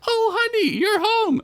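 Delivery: very high, sweet wife voice. Acted. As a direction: greet him like a doting wife. Wife greets Bilbert.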